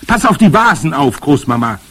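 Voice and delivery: high, loud, urgent caution; alarmed warning. A narrator's reading. Boy warns grandmother swinging her stick, alarmed warning. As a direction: warn her before something breaks.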